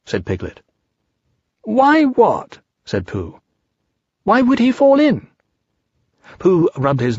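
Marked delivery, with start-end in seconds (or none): 1.6-2.6 high-pitched Pooh voice; Pooh's puzzlement. Acted. Pooh answering Piglet's question, puzzled high voice. 4.2-5.3 high Piglet voice, wondering; Piglet's questioning. Acted. Piglet querying Pooh's trap plan, high puzzled voice.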